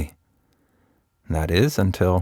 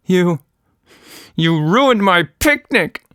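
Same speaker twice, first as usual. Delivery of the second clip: through clenched teeth; 11 semitones higher, 4 dB louder than usual. Acted. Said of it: "she said through clenched teeth".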